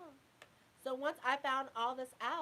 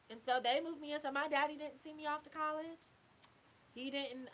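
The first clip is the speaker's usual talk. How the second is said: ordinary talk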